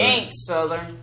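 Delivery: "It ain't Southern" in a put-on Southern twang; mock Southern accent. Co-host echoing the joke line in a mock Southern accent. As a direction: lay on a fake Southern accent.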